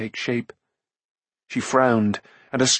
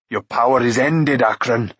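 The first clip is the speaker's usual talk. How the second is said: forceful declaration to a beaten foe; understated shout of defiance; triumphant cry. A narrator's reading. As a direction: cry it out firmly in a hero's voice. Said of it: "he cried".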